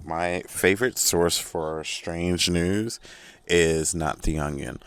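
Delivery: normal talking voice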